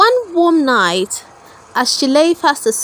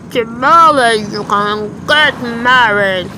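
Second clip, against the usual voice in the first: croaking toad. A narrator's reading. Ugly toad plotting a wife for his son, slow croak. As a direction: croak it out slowly like an old toad. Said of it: "croaked the old toad to his son".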